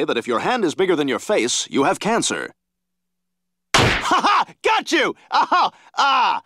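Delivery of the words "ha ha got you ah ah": high triumphant laughing gotcha; gleeful gotcha. Acted. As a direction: gloat over the prank. Gotcha prank reveal.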